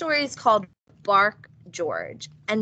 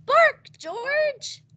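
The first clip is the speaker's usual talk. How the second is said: mother's voice. A narrator's reading. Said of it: "George's mother said".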